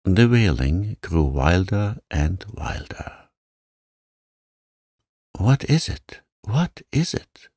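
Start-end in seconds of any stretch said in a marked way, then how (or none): 5.4-7.5 high, frightened question; acted panic, rising high on each question; alarmed gasp. A narrator's reading. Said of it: "Missus Lennox gasped".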